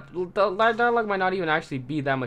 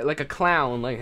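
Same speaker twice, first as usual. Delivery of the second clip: usual speaking voice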